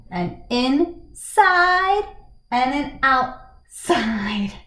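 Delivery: very slow, gap before each "side"; drawn-out punchline. Shown as a demonstration. Teacher giving a shape joke's punchline, slowly drawn out. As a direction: stretch and separate in-side and out-side.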